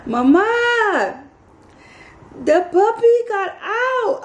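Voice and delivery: high, drawn-out distressed wail; imitated frantic, high-pitched neighbor; freaked-out neighbor. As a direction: wail it in a panic, far above your own pitch. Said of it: "it freaked my neighbor out".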